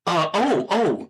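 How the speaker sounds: caught-off-guard motherly fluster; embarrassed mother. Acted. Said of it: "rather embarrassed".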